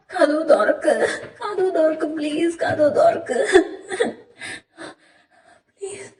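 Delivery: bang on the door and beg through panic; panicked begging. Acted. Locked in the dark, he begs his mother to open the door in terror.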